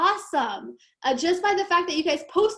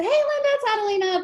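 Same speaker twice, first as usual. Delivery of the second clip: bright voicemail greeting; 9 semitones higher, 3 dB louder, faster than usual. Retold or imitated. Retold cheery voicemail to broker.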